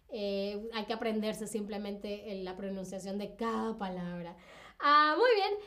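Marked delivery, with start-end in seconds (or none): 4.8-5.5 high, loud burst of approval; light up at the right answer; delighted praise. Real, not acted. Teacher praising the chat's answer, delighted.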